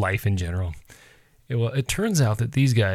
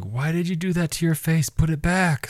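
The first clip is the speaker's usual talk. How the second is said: put-on kids complaining; 7 semitones higher than usual. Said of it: "the girls are like".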